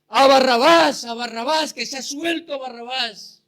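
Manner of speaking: crowd shouting. Retold or imitated. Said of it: "la multitud gritaba".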